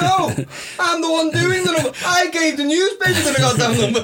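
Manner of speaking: high-pitched, laughing indignant retort; laughing mock indignation. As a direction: play her outraged, laughing through it. Acting out Ruth's indignant retort.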